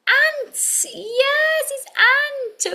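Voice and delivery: high, bright excited reveal. Acted. Teacher celebrating the word the puppet built. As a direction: cheer the answer out.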